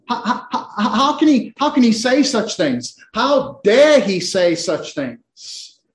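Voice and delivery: stammering start, then a slow, stressed HOW DARE; indignant disbelief. Acted. Synagogue listener reacting to Jesus' claims, indignant.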